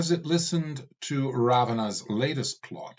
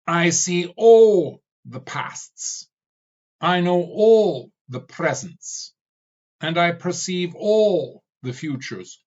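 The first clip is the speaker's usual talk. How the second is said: higher, louder, slow declaration; vehement; 7 semitones higher, 7 dB louder than usual. Acted. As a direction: thunder out each claim. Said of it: "He answered the skull vehemently".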